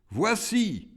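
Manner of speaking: high-pitched rebel's voice; rebel demon. A narrator's reading. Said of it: "dit le rebelle".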